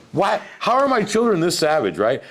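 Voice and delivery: high, loud incredulous questioning; baffled disbelief. Retold or imitated. Dad reacting to his kids' rude gesture, loud disbelief. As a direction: exclaim it as a flabbergasted parent.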